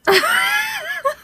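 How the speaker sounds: high wheezing laugh; wheezy laugh. Real, not acted. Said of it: "I sound so wheezy".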